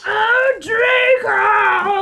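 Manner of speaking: high, loud hammed-up cry of Draco; overdone swooning yell; mock ecstatic cry. Reading the fanfic sex scene aloud, hammed-up shout.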